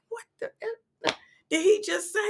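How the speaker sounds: a half-hushed, high "What the F"; a little mad. Retold or imitated. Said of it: "I get a little mad".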